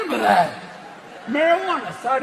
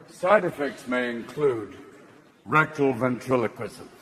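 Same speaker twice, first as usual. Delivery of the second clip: much lower, quiet drug-ad read; ad disclaimer voice; 11 semitones lower, 6 dB quieter than usual. Acted. Drug ad listing side effects, low quiet read. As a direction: murmur it like fine print. Mock warning label voice.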